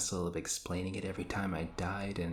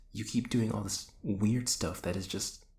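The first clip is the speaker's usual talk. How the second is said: ordinary delivery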